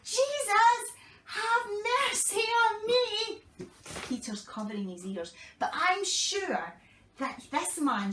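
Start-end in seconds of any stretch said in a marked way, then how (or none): 0.0-3.4 high, loud pleading shout; shouting louder. Acted. Blind man shouting louder though people shush him. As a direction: yell it out even louder. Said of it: "He shouted even louder".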